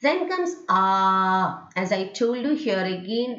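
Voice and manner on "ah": "ah" held long and steady; prolonged ah. Shown as a demonstration. Teacher introducing the symbol with two dots, prolonged ah. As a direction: stretch out the vowel "ah". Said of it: "you have to prolong it".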